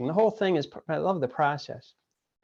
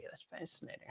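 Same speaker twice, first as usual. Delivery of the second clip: whispered aside; 21 dB quieter, faster than usual. Real, not acted. Aside after Texas accent.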